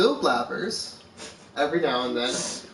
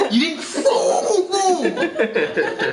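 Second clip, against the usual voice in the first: mock exasperation; 9 semitones higher, 4 dB louder than usual. To the friend who asks "did you catch that", mock exasperated. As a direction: stretch the last word in baffled protest. Retelling baffled reply to Trinity.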